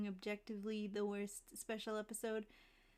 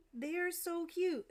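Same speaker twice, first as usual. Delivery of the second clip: gushing; 7 semitones higher than usual. Real, not acted. Gushing over cute faces.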